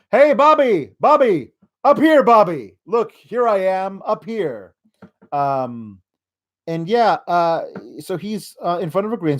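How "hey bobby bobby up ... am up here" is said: mock calling out. Rusev calling out to Bobby, higher mock calling voice. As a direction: wave him over from above. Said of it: "Rusev calling to Bobby".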